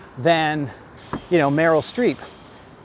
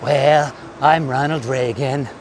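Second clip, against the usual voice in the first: lower-pitched presidential impression; shticky impressionist voice, pitched down; Ronald Reagan; 4 semitones lower than usual. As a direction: do an impressionist's take on a famous president. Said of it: "like Rich Little would do".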